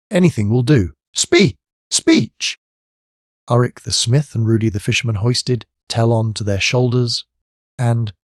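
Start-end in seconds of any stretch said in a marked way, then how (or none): 0.1-2.6 very high eager cry; eager crowd cry. A narrator's reading. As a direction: press him for a speech. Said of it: "Everybody took up the cry".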